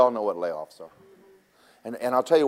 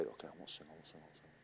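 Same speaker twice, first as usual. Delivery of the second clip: much softer, lower, sheepish repeats; sheepish apology to the annoyed instructor, very soft; meek quiet promise; 6 semitones lower, 26 dB quieter, faster than usual. Retold or imitated. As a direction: mumble it like a scolded kid. Meekly promising instructor to stop singing.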